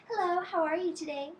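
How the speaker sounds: a gentle, pleasant hello; nice greeting. Shown as a demonstration. Showing a child the polite princess greeting, gentle and sweet. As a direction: greet your friends sweetly, no yelling. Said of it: "We wave like this and we say".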